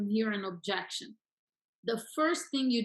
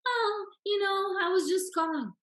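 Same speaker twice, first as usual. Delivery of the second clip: meek agent; 9 semitones higher, 3 dB louder than usual. Acted. Coach playing agent answering an angry seller, meek. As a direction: drag it out timidly, imitating a mismatched agent. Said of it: "the opposite is also not gonna work".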